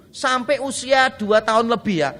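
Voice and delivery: ordinary talk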